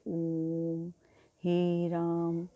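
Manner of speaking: "ओम" and "हे राम" with the vowels held very long and slow; prolonged vowels. Shown as a demonstration. Teacher showing pluta vowels to the class, drawn out very slowly. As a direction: stretch "ओम" and "राम" out, three beats long. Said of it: "तीन गुना समय लगता है".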